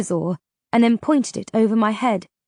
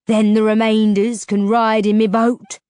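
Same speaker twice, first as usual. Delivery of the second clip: louder savage threat; 5 dB louder than usual. A narrator's reading. Ferryman threatening the travellers, savagely. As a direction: snarl the threat. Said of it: "he ended savagely".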